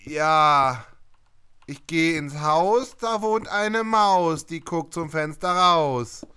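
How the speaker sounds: slow, plodding recital of a silly rhyme; clumsy schoolboy rhyme. Acted. Gamer showing friend his own bad school rhymes, slow mock recital. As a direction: draw out each line like a schoolkid reciting. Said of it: "und ich so".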